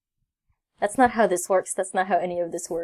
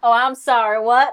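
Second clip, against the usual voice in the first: louder on purpose; mock loud; 4 semitones higher, 6 dB louder than usual. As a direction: shout the apology to tease. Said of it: "Can you not yell?".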